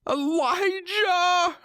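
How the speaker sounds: very high, slow, loud sobbing wail; sobbing cry. Acted. Wife crying out to her husband, sobbing wail. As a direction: wail his name through sobs. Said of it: "Her sobs were heart-wrenching".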